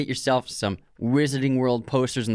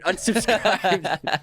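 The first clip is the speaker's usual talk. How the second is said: laughing; 7 semitones higher, much slower than usual. Acted. Reading comment sign-off.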